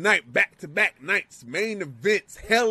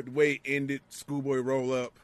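ordinary talk

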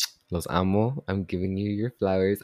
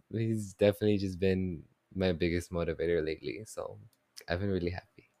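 normal talking voice

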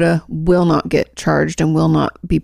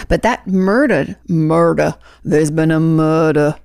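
drawn-out Southern "murdah"; slow mock Southern accent, dropping the r; slower than usual. Teasing the trial news to co-host, mock Southern accent. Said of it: "said with that accent".

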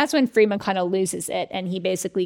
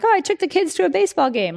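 put-on higher, brighter-toned casual chatter about the kids; breezy chatty quote; 9 semitones higher, 4 dB louder, faster than usual. Schwartz's letter to Friedman, chatty high voice. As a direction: toss off the family news lightly. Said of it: "Schwartz will be like".

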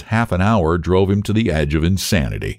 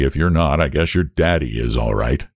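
lower, grudging clerk's reply; hesitant admission; 6 semitones lower than usual. A narrator's reading. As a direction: concede it in a low, unsure voice. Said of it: "admitted the clerk hesitantly".

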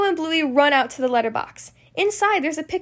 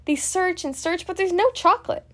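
usual speaking voice

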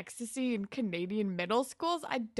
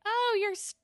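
imitated gushing compliment; 10 semitones higher than usual. Ashley being nice to Terry, high gushing voice. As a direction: gush in a high, dreamy voice. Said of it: "Ashley's not a bitch to Terry".

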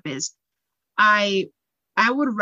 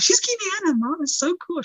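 put-on excited child; 10 semitones higher than usual. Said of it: "I was like".

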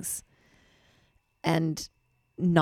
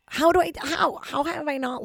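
exasperated high whine; 10 semitones higher than usual. Real, not acted. Frustrated outburst.

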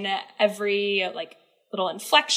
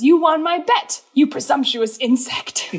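higher, louder, slow scornful Higgins; mock Higgins scolding Eliza, higher and louder; mock Higgins sneer; 5 semitones higher, 5 dB louder, slower than usual. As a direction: sneer it like a pompous professor. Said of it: "he says".

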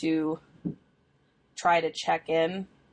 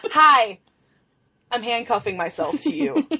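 overbearing mom voice; 5 semitones higher, 8 dB louder than usual. Pretend. Clingy mom act.

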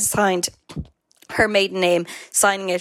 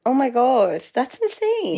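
amazed; 5 semitones higher, slower than usual. Real, not acted. Shocked by the bear story.

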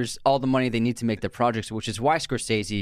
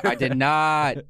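normal talking voice

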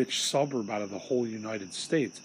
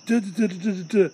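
mocking nagging babble; 8 semitones higher, 6 dB louder, faster than usual. Mocking mom's ranting as babble.

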